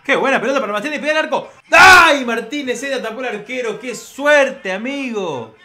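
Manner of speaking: high, loud shouts fading into frustration; excited outcry, easing. Real, not acted. Reacts to a saved shot. (grito exaltado que se va apagando)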